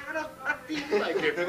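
put-on high baby voice breaking into a laugh. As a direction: squeak it out as a baby, cracking up. Baby's punchline.

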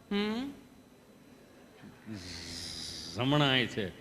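slow, low, halting hum before the words; stammering patient. Retold or imitated. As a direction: stall with a hum, then mumble the answer. Gabho voiced, stammering reply.

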